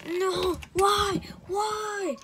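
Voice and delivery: high sobbing yell of no and why; crying shout. Acted. Letter being taken away, shouting and sobbing. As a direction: scream it through tears.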